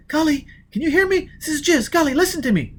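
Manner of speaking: put-on high, urgent young woman's voice; Jiz's high voice. Jiz calling Foyle over the earphones, high and agitated. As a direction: pitch way up and press with worry.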